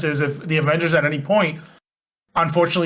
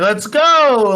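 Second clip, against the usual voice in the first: imitating a raised, higher-pitched battle call; punchy mock shout, pitched up; rallying shout; 6 semitones higher, 4 dB louder than usual. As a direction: call the team to action. Acting out hero firing a gun.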